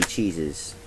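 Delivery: ordinary talk, nothing marked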